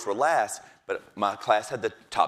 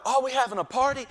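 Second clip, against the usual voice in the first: much higher eager question; excited kids; 13 semitones higher, 3 dB louder than usual. Retold or imitated. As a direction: kids thrilled to see balloons. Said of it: "the kids were like".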